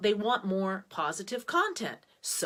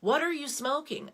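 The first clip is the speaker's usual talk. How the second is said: "What ARE you smoking?" pitched higher, incredulous; exasperated disbelief; 7 semitones higher than usual. Retold or imitated. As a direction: throw the question back, higher and baffled. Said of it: "And she doesn't smoke".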